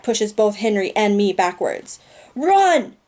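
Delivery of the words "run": sharp high shout of warning; yell. Acted. Henry yelling at the boy to flee, a loud high shout. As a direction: shout it to get him running. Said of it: "Henry yells".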